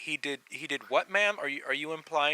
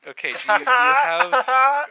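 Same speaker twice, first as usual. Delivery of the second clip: fake caller voice; 8 semitones higher, 10 dB louder than usual. Prank caller bit, drawn-out.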